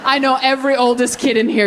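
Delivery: ordinary delivery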